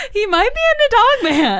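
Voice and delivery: high, laughing joke. Real, not acted. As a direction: say it while still laughing. Joking through laughter.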